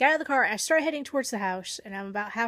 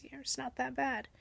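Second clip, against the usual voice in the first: whispered aside; 3 semitones higher, 7 dB quieter than usual. Real, not acted. Aside about the cold.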